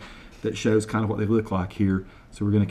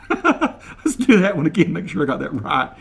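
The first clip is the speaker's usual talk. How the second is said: ordinary delivery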